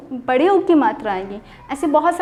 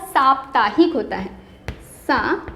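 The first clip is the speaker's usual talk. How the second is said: ordinary delivery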